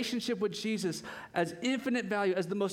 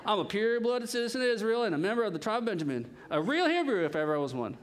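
quick, hammed-up Southern boast; mock Southern preacher reading; Southern accent; 3 semitones higher, 3 dB louder, faster than usual. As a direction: brag in a playful Southern accent. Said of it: "why am I reading that way?".

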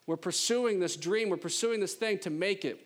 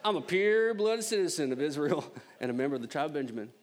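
Southern accent. Shown as a demonstration. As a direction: play up the Southern accent, amused. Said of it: "I kinda like to read this in a more southern accent".

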